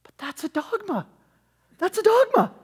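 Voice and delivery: very high mock inner-thought voice; high, thin aside voice mocking the speaker; Gaffigan inner voice. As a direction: squeak it out like the audience's hidden thought. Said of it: "goes into a high-pitched voice to answer it".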